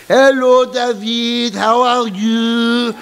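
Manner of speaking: put-on high, drawn-out French-accented greeting. French head greets student David, in a French accent. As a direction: sing out a long, high French-accented hello.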